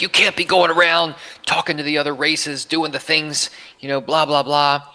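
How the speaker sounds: high mock yell of an angry inmate. Retelling the gang member ranting at him, high mock yelling. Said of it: "so yelling".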